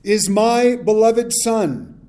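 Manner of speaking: slow, loud, lightly voiced divine pronouncement; voice of God. The voice from the cloud to the disciples, slow and loud. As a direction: read it slowly like a voice from the cloud. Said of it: "a voice came out of the cloud saying".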